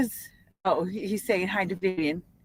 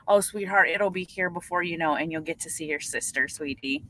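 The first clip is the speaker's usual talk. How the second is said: low, soothing and softening to the end; sweet, consoling reassurance; tender reassurance; 6 semitones lower than usual. Real, not acted. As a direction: comfort them gently, voice settling lower. Consoling an anxious waiting viewer.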